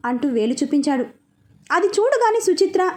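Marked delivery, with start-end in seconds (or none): none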